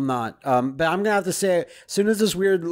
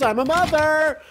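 mother voice; 10 semitones higher than usual. Acted. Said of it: "passive-aggressive remarks".

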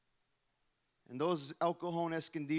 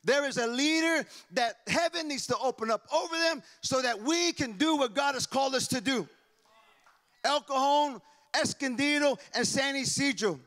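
raised, higher preaching voice; fervent pastor preaching to his church; 8 semitones higher, 8 dB louder than usual. Acted. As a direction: preach it out with full force.

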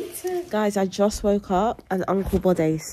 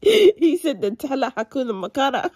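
laughing; 5 semitones higher, 4 dB louder than usual. Retold or imitated. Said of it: "That was not English".

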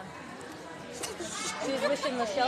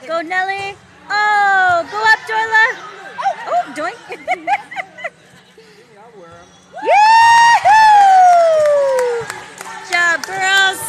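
loud, high whoops and calls; cheering shout; 7 semitones higher, 11 dB louder, slower than usual. Real, not acted. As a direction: whoop for the team. Parent cheering at girls' game.

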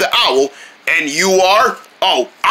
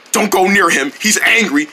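ordinary talk, nothing marked

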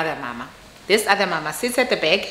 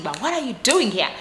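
ordinary talk, nothing marked

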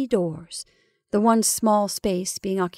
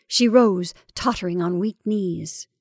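usual speaking voice

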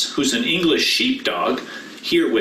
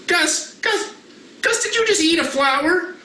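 high startled calls of the name; hammed-up surprise at the dog; mock alarm; 11 semitones higher than usual. Pastor catching the puppet dog eating, mock alarm.

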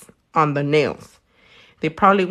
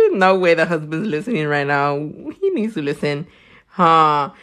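ordinary delivery

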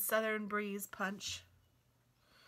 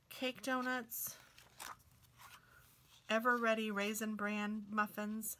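ordinary delivery

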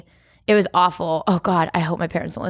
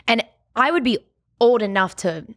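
ordinary delivery

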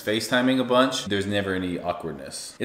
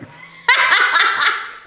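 shrieking burst of laughter; high loud laugh; 8 dB louder than usual. Real, not acted. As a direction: cackle up high.

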